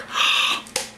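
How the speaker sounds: breathy staged gasp; mock awe gasp. Comic acting the awestruck Israelites, a staged gasp.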